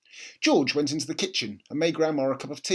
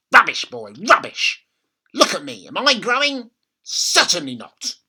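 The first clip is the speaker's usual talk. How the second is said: put-on higher, louder bossy old woman; bossy grandma; 6 semitones higher, 10 dB louder than usual. A narrator's reading. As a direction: order the boy about. Grandma scolds George.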